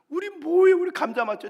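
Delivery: high, loud pleading; desperate plea. Acted. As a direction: beg for an answer, voice up. Potato pleading to elder.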